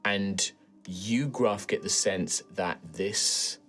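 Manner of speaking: slow, hushed scene setting; game master narration. Acted. Game master reveals the forest's anger to Gruff, hushed.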